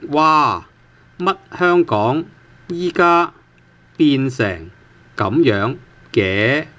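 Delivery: word by word, with gaps between phrases; slow model reading. Shown as a demonstration. Teacher reading the entire example sentence to learners, slowly and in pieces. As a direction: read it out slowly for learners, stretching the syllables. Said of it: "so the entire sentence reads".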